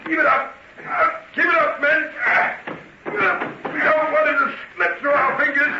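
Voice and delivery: high, urgent commands over the noise; shouted orders. Acted. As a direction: drive the men on as they break the door. Inspector orders door smashed.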